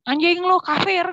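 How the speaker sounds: a sharp high snarl of insult; mock angry curse. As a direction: spit it out like an angry father. Father curses her on phone. (makian ayah yang marah, ditirukan)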